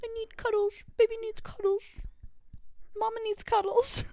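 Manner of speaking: put-on high cutesy baby voice; baby talk. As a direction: whine for cuddles like a spoiled toddler. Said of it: "use the word cuddles".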